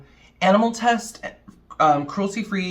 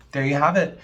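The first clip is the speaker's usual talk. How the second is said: usual speaking voice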